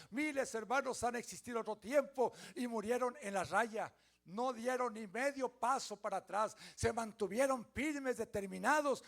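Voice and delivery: loud, ringing preacher's voice lifted high; full-force preaching. Acted. Pastor rousing the congregation: loud, fervent preaching. Preaching about faithful martyrs.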